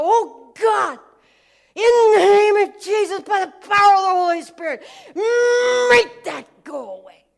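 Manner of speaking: loud, high, pleading cry of a prayer; hammed-up wailing plea to God; mock desperate prayer. Preacher mocking a frantic prayer, loud pleading cry. Mocking people praying to erase consequences.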